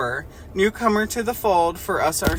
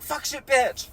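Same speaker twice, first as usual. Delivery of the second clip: imitating outburst; 10 semitones higher, slower than usual. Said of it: "the bitch has Tourette's and goes like".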